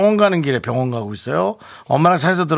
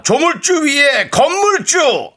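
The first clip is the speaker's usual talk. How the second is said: dramatic title read; 9 semitones higher, much slower than usual. Acted. Segment title.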